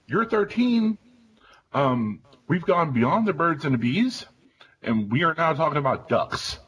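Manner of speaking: high-pitched incredulous dad; mock-bewildered, voice shooting up high; retold disbelief. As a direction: squeak it out in baffled parental shock. Retold shocked parent's reply.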